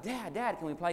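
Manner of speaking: put-on high, pleading child's call; a kid who can't wait any longer; eager boy. Son runs up to his dad begging to play ball, high and eager.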